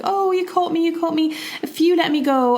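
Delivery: lightly voiced magic fish, high-pitched plea; pleading fish. Magic fish begging the fisherman to release her, lightly acted pleading. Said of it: "and she's like".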